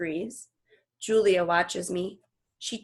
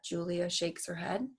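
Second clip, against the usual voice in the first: usual speaking voice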